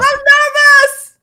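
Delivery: loud, high, drawn-out wail; nervous scream. Real, not acted. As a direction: scream it out in a panic. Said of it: "Why are you screaming like that".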